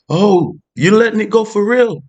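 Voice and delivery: karma thrilled you really let go; gleeful high-pitched karma; delighted karma. Retold or imitated. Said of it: "And karma start reading your heart".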